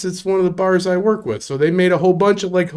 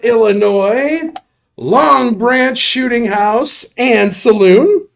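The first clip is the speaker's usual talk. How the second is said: raised, drawn-out show-host announcement; announcer call-out; 5 semitones higher, 4 dB louder, slower than usual. Acted. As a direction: stretch out each name like a big reveal.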